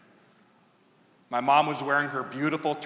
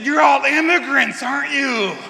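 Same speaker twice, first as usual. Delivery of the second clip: stoned shout; 11 semitones higher, 8 dB louder than usual. Retold or imitated. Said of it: "And I said".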